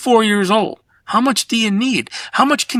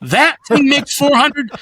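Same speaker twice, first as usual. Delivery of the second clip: mocking scorn; 7 semitones higher than usual. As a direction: sneer at the overpaid art director. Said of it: "And I was like".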